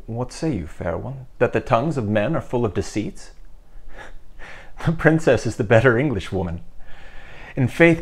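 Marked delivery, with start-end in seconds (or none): 3.4-4.8 soft laugh. Acted. Henry laughs at her French.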